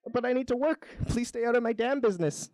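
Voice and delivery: high-pitched beaver in a squabble; protesting beaver. A narrator's reading. Beaver argues with the tree, high-pitched protest.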